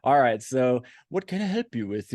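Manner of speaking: lightly put-on British sommelier accent; a hint of a polite British shop voice. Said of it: "he has a British accent".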